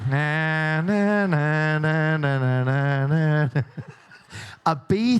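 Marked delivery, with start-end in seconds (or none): 0.1-3.5 low, sustained plane noise; imitated bomber drone. Host joking that a bomber is coming in, droning plane imitation.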